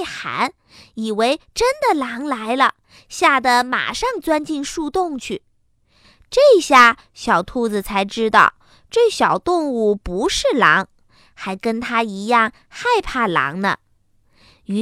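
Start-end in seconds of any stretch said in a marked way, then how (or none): none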